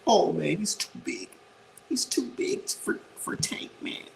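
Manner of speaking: high, breathy whine mocking the doubters; whiny mocking quote. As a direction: mimic them whining like scared fans.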